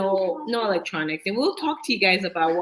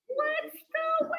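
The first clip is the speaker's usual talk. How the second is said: sing-song announcer; 6 dB quieter than usual. Pretend. Announcing the next segment.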